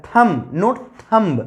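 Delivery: thumb with no b, then thumb with a b at the end, slowly; slow contrast of thumb without b and with b; right then wrong. Shown as a demonstration. (पहले thumb बिना बी, फिर बी के साथ, धीरे)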